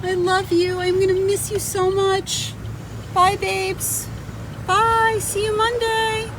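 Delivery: high sweet pet talk; tearful goodbye. Real, not acted. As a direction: coo goodbye to the dogs, voice way up. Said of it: "I said bye to my babies".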